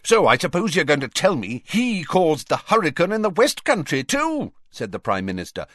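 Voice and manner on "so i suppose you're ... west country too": high, angry sarcastic challenge; exasperated question, pitch climbing; rising temper. A narrator's reading. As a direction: let the temper climb to the final word. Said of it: "his temper rising with every pace".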